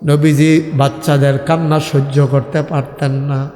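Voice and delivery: normal talking voice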